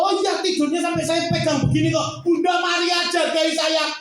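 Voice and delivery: pleading prayer. Retold or imitated. Acting out his desperate prayer.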